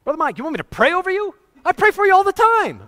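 high, fast, excited exclamations; the elderly friend bursting with eager surprise; excitable old pastor. Retold or imitated. Elderly pastor to Brother Mike, surprised he'd ask for prayer, high excited. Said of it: "a very excitable guy".

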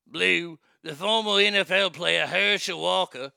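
mock British news reading; British accent. Shown as a demonstration. Host reading a reparations story, British accent with some Irish. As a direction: read it out in a put-on British accent. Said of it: "you can do a British accent too".

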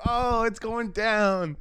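high, slow hype voice; lightly hyped announcer; hyped narrator voice. Retold or imitated. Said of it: "and it's like".

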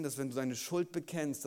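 ordinary talk, nothing marked

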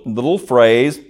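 normal talking voice

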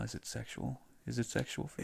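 soft breathy close-mic tease; right in your ear. Acted. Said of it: "whispering in your ear".

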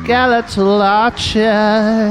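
slow, hammy skeleton drawl. Pretend. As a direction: croak it out like a bony archer. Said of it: "do your best skeleton voice".

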